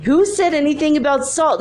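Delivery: high-pitched incredulous question; baffled protest. Retold or imitated. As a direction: act out baffled disbelief. Acting out own baffled protest.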